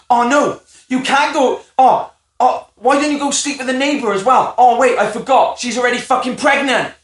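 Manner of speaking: mock sarcastic rant. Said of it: "she said something to him like".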